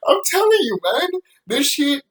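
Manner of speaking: high loud laughter through the words; can't stop laughing; laughing hard. Real, not acted. Vlogger laughing about the show, high and loud.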